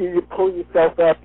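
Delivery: ordinary delivery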